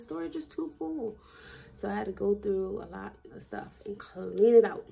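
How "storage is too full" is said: phone message. Retold or imitated. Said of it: "It says".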